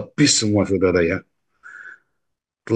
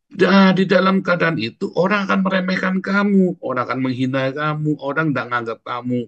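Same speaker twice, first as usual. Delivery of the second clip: fervent preaching; 6 semitones higher than usual. Acted. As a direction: exhort the congregation with rising passion. Preacher exhorts the congregation. (khotbah berapi-api dengan suara tinggi)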